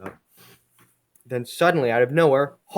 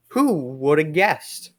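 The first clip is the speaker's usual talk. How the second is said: slow, louder, drawn-out fake amazement; mock surprise; 3 semitones higher, 5 dB louder, much slower than usual. Comic's author teases about the obvious escape, mock surprise. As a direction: stretch it out sarcastically. Sarcastic aside on obvious plot.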